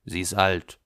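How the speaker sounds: curt, understated gruffness; gruff. A narrator's reading. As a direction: cut it short, a touch brusque. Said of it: "sagt schroff". (etwas schroff)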